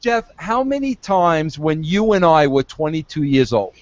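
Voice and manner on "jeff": usual speaking voice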